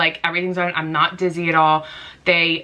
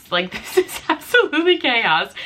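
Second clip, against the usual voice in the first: higher, laughing through chaos; 5 semitones higher than usual. Real, not acted. Looking at Chris in the ER, laughing in disbelief. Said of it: "We just start laughing".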